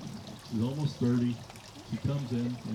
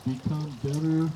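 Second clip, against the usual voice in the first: normal talking voice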